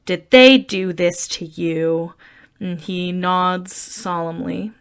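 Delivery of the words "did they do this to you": a touch of a matronly, slow, louder tone; lightly voiced mother soothing a child; motherly, matronly. Janet fussing over Rocky's scrape, lightly matronly. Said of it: "it's kind of a motherly, like, matronly type of".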